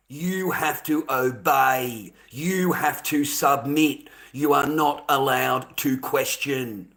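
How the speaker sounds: very slow, word-by-word mock command; slow mocking decree. Real, not acted. Streamer sneering at a viewer's demands, slow mock decree. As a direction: spell out obedience like a scornful dictator.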